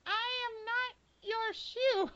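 very high, very slow squeak; tiny squeaky voice. Retold or imitated. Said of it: "this tiny squeaky voice say".

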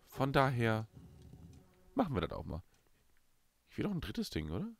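normal talking voice

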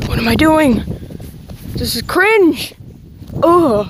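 high, loud, slow groaning yell; cringing yell. Real, not acted. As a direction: shout at your own cringe. Self-embarrassed outburst.

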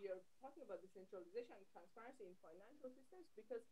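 very faint and far off; nearly inaudible, like a dropped connection; barely audible. Real, not acted. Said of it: "[name], your voice is very low".

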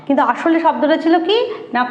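ordinary talk

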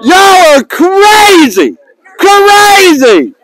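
high, slow, stretched-out holler; hollered exclamation. Real, not acted. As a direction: holler it to the whole crowd, dragging the vowels. Hollering at the gathered players.

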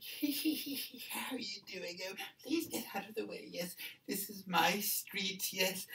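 high, affected, haughty lady with a tittering hee-hee; rich lady. Acted. Second witness mimics rich lady claiming her street, high and haughty. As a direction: lord it over the street in a high voice. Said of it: "this really rich lady".

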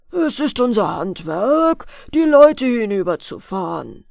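put-on boatman voice; boatmen. A narrator's reading. Boatmen tell the girl ferrying is their trade, in character. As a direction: answer as the ferrymen, with emphatic punch. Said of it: "antworteten die Schiffer".

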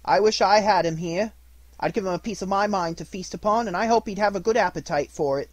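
high, loud and fuming; angry wife. Acted. Mrs Cratchit rants about Scrooge, high and loud. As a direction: give him a piece of your mind. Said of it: "cried Missus Cratchit".

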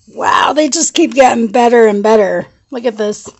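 ordinary delivery

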